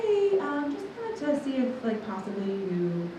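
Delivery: high, soft, hesitant mom voice; sing-song high start, quietly feeling it out; tentative mom. Retold or imitated. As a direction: imitate a mom tiptoeing into an awkward question. Mom's awkward probing question, voiced.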